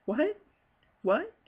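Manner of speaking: high, loud, rising "What?" in shock; can't believe what he just heard; stunned disbelief. Retold or imitated. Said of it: "I remember him saying".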